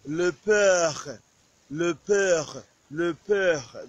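le père with a throaty French r. Shown as a demonstration. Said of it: "you have to say le père".

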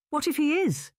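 sharp, high, indignant comeback; indignant retort. A narrator's reading. Henrietta to sister about spinsterhood, indignant high retort. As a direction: snap it back in defiance. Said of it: "Henrietta retorted indignantly".

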